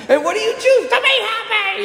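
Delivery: high mock shout through laughter; laughing dad voice. Dad shouting after the girls, voiced high through laughter. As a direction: laugh while hamming up the dad. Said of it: "'cause he's like".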